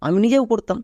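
usual speaking voice

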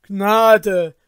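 loud plea for mercy; crying for mercy. Acted. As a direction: beg loudly, throwing themselves down. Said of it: "Sie stürzten nieder und riefen".

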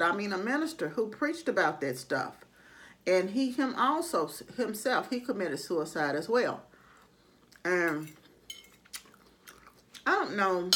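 talking with mouth full; mid-bite speech; chewing food. Real, not acted. Seen 4: forkful taken in mouth at 1.5–2.2s, then chewing while speaking.

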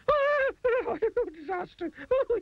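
very high hysterical shriek; terrified scream. A narrator's reading. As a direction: scream at a looming face. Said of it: "he screamed".

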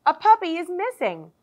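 mother dog alerting the pups, loud announcement; announcing. Acted. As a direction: call it out to the whole park. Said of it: "Missus Bulldog announced".

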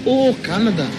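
made-up Canadian accent, hammed-up; mock Canadian accent. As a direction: put on a joking Canadian accent. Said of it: "that's like a made-up accent".

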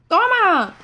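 grito de victoria agudo y fuerte; ¡toma! celebrado con fuerza; celebración. Real, not acted. Celebra en el juego. (exclamación de triunfo)

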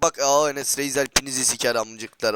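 usual speaking voice

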